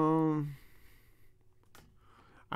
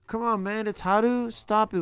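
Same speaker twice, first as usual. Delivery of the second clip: louder, higher "come on" in mock frustration; annoyed protest at a tricky word; exasperated; 6 semitones higher, 6 dB louder, faster than usual. Real, not acted. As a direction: complain at the app, half laughing. Frustrated by a language quiz.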